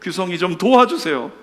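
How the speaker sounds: high, loud, drawn-out plea, easing down at the end; imitated tearful prayer. Friend praying for his heartbroken classmate, imitated tearful pleading. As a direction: cry out to God, then sink into pleading. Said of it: "제가 울면서 이렇게 했습니다".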